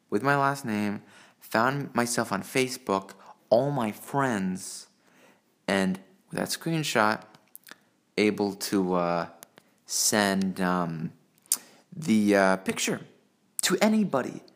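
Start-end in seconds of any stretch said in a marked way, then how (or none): none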